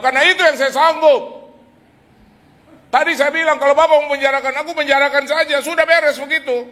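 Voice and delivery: high, loud bravado; loud and high defiant retort; defiant shout. Retold or imitated. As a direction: pretend to be fearless, push back hard. Defying the collectors, afraid inside.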